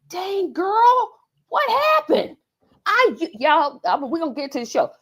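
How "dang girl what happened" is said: high, startled "what happened"; mock shock. Talking to her mirror, a high mock-shocked exclaim. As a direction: gasp at her own reflection. Self-talk quote in mirror.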